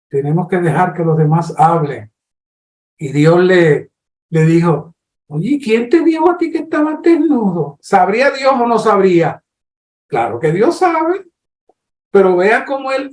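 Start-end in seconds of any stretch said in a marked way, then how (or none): 5.3-7.8 higher, gently probing question; a hint of God as a patient questioner; God's questioning voice. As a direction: coax a confession out of him.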